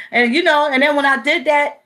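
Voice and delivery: ordinary talk, nothing marked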